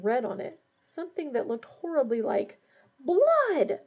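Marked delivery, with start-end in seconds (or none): 3.0-3.8 loud, high cry; yelled. Acted. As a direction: yell it into the silence. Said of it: "Ron yelled".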